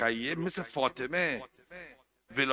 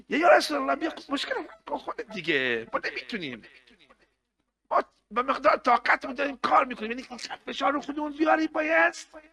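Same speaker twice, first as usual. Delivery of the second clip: pleading prayer; 13 semitones higher, faster than usual. Acted.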